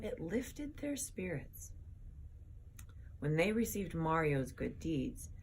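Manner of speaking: ordinary delivery, nothing marked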